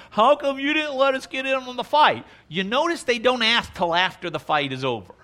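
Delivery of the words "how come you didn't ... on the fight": high, aggrieved whine; whiny complaint. Retold or imitated. Ephraim complaining to Gideon, high and whiny. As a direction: mimic a sulky grumbler who missed out. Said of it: "But they complained to him".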